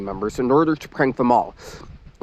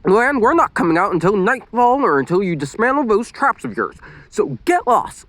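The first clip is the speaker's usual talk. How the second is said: snapping; 9 semitones higher than usual. A narrator's reading. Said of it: "Lily snapped".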